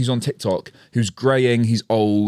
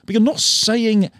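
exasperated retort; 12 semitones higher, slower than usual. Retold or imitated. Retort to the hype man.

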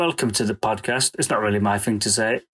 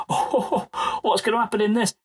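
hammed-up breathless excitement, higher and quick; imitating the audience with mock excitement about a known story; mock eager listeners; 8 semitones higher than usual. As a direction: play a listener faking suspense. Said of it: "and you all had to go".

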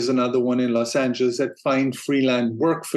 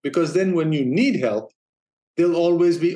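normal talking voice

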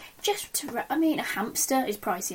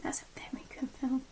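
whisper; 2 semitones higher, 10 dB quieter than usual. Real, not acted. Hushed agreement in chat.

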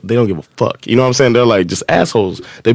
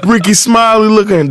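mocking; 10 semitones higher, slower than usual. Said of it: "the bus erupts like".

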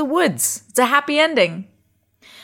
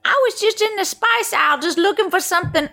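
high quoting voice; 10 semitones higher, faster than usual. Retold or imitated. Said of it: "Oh, no, wait, she's Australian".

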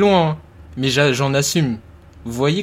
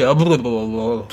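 Mister Bean mumble; 4 semitones lower than usual. Retold or imitated. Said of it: "quand il parle, il fait".

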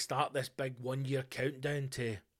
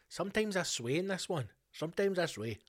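a hint of an Indian accent, pitched higher; mock Indian accent; 5 semitones higher than usual. Podcaster answering a listener question in a mock Indian accent. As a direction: lightly slip into an Indian accent. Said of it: "I don't know why I went Indian".

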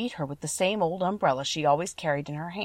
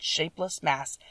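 plain reading voice

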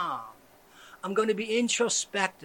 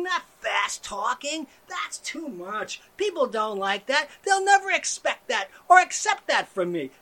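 usual speaking voice

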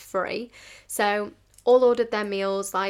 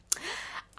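long, soft breathy sigh; exasperated sigh; 11 dB quieter than usual. Retold or imitated. Waitress facing an arguing customer, exasperated sigh. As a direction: let out a weary breath.

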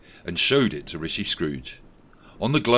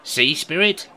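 raised, louder character voice; Rishi Scrooge voice; 8 semitones higher, 5 dB louder than usual. A narrator's reading. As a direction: voice the politician Scrooge. Scrooge voiced in read story.

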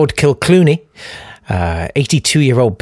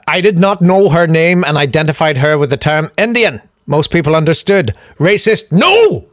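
put-on Irish accent. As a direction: play an indignant Irish peer denying it. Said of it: "there's me doing an Irish accent".

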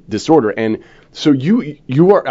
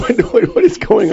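mock alarm. Husband reacts with high mock alarm.

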